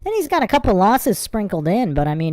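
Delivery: ordinary talk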